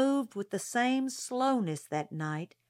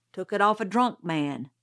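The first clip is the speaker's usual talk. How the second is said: usual speaking voice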